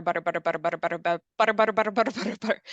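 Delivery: "butter" repeated rapidly in a chain; as fast as you can. Shown as a demonstration. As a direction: rattle off "butter" at top speed. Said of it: "saying the word butter as fast as you can".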